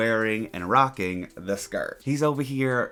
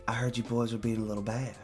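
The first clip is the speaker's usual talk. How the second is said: put-on Southern accent; 5 dB quieter than usual. Voicing Yoon Karen to the boys in a Southern accent. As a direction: purr it like a sassy Southern lady. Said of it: "Why do you have a little Southern accent?".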